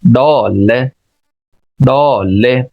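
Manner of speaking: डॉल as DAW-luh, rounded vowel, silent r; British pronunciation. Shown as a demonstration. Teacher corrects Urdu speakers, डॉल rounded without final r. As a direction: round the first vowel, drop the r. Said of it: "बल्कि पढ़ेंगे".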